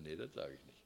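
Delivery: soft chuckling no; laughing refusal. Retold or imitated. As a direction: laugh it off quietly.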